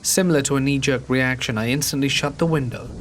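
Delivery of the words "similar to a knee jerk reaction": ordinary delivery, nothing marked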